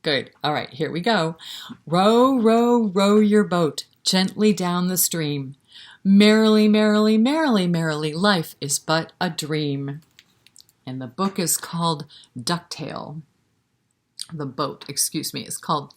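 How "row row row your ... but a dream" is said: louder, bouncy sing-song; louder sing-song nursery rhyme; rhyme recital. Acted. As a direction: belt out merrily with the kids.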